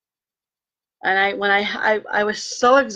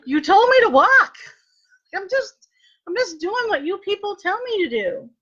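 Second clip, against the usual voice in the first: much higher, exasperated protest at the nurses; exasperated retort; 12 semitones higher than usual. Retold or imitated. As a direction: throw it back at them, fed up and pitched up. Retold exasperated reply to nurses.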